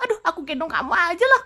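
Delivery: normal talking voice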